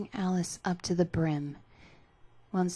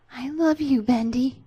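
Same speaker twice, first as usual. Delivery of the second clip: tender; 7 semitones higher, 10 dB louder than usual. Acted. Alice tenderly confessing love.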